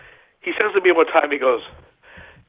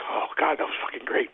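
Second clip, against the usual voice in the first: stunned whisper; 9 dB quieter than usual. Retold or imitated. Retold stunned reaction.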